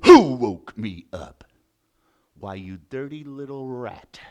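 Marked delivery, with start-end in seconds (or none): 0.0-1.4 loud, slow lion bellow; angry lion. Acted. Lion woken by the mouse, bellowing angrily. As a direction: roar out the demand, drawn out.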